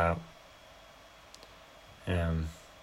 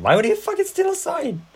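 high, loud exasperated cry; indignant outburst; 7 dB louder than usual. Real, not acted. Friend ranting about the film, squealing high in disbelief. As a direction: fling the question up in disbelief.

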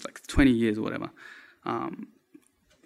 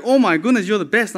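higher-pitched gushing thanks, retold; amazed gratitude; 6 semitones higher than usual. Grateful client thanking the videographer for a free reshoot, amazed gushing. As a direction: gush like a disbelieving client. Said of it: "they couldn't believe it. The client was like".

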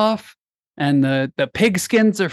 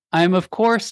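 ordinary talk, nothing marked

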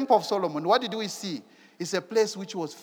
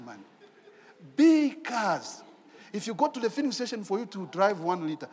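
ordinary talk, nothing marked

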